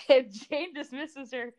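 ordinary talk, nothing marked

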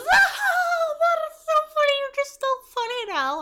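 very high, loud, laughing squeal; mocking her laughing gush. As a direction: cackle it up like an overeager fan. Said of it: "She goes".

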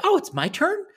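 high-pitched feigned surprise; mock surprise. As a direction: act pleasantly surprised, pitch shooting up. Gleeful turn coming round in a story.

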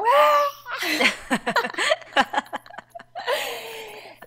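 high burst of laughter; loud laugh. Real, not acted. Laughing after the almost-sneeze.

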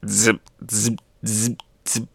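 soft, buzzy "zip" bursts; zapping noises. Acted. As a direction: imitate little crackles fading away. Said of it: "you see the zap".

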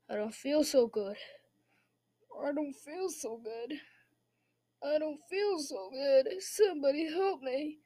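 feeling sick. Pretend. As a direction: play a queasy child pleading feebly for help. Acts Jimmy feeling sick.

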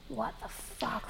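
slow, hushed disbelief; two sisters stunned by mom's calm reaction, whispering. Retold or imitated. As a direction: breathe it out, stunned. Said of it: "Madison and I are like".

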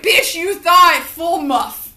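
a loud, slow, defiant retort with full attitude; sassy comeback. Pretend. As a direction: drag each word out to shut the boyfriend down. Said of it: "I'm gonna be like".

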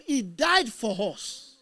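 usual speaking voice

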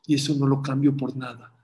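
normal talking voice

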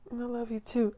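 high, very soft imitated girl; loving girlfriend. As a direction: coo it back as his girlfriend. Voices girlfriend on the phone.

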